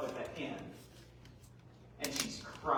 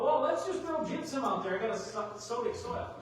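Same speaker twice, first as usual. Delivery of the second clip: higher-pitched mock farmer quote; naive farmer; 8 semitones higher, 4 dB louder than usual. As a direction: mimic someone tossing out easy advice.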